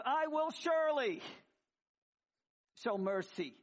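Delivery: 하나님의 목소리로 "surely"를 천천히 높고 크게 강조; emphatic surely. Retold or imitated. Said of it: "He goes".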